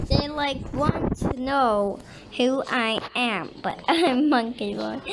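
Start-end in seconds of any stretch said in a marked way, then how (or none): none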